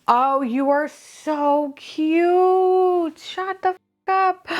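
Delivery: high, very drawn-out coo; gushing delight. Real, not acted. Collector finally meets the kitten figure, high slow gush. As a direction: stretch it out in adoring disbelief.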